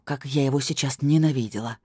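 ordinary delivery

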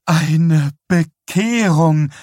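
mother rejoicing loudly at the hospital bedside; jubilant cry. A narrator's reading. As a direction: cry it out in religious rapture. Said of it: "rief Vera jauchzend aus".

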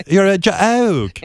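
put-on slow British accent with weight on joke; mocking a British host in a British accent; British accent scolding. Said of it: "I love that accent when somebody yells".

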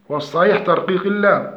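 normal talking voice

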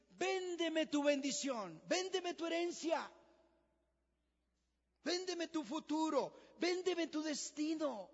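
preaching at full force, hammering each véndeme; devil tempting. Acted.

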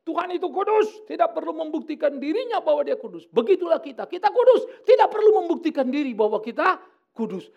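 loud, high imitation of a cocksure believer; mock bold declaration. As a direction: proclaim it mockingly at full volume. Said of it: "Jadi jangan bilang gini". (seruan lantang menirukan orang sok yakin)

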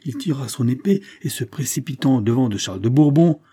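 ordinary delivery

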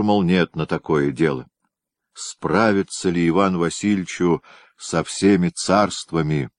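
usual speaking voice

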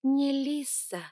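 "Не" punched high and loud; "Не Лиса" slow, stressing "Не". A narrator's reading. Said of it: "и я поправила".

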